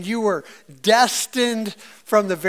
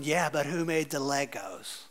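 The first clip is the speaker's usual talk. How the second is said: wry God; 5 semitones lower, 8 dB quieter than usual. Retold or imitated. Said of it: "God's going".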